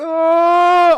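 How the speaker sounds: high loud mock scream going down; retold scream. Going over the edge, letting out a mock terrified scream. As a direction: scream 'Ah' on the drop. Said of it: "I am already screaming".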